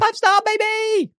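squealed sky-high hype call; hype shout. Acted. Co-host hyping listeners for five-star reviews, excited squeal. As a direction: cheer it like a hype man, drawn out.

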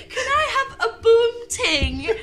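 put-on high, slow old lady plea; man doing granny voice. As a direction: ask slowly like a sweet granny. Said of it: "In an old lady voice".

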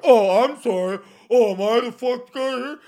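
loud, high-pitched mock-offended apology; exaggerated indignant whine of "oh, I'm sorry". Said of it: "get offended".

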